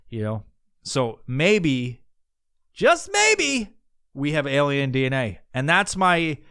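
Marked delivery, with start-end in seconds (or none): 2.7-3.8 high-pitched aside. Pretend. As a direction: voice leaps way up. Playful emphasis, voice jumps very high.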